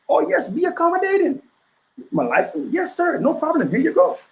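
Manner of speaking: high, sugary yes-sir voice at a traffic stop; mock accommodating driver. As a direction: play the overly eager driver handing over his licence. Mocking an overly polite driver.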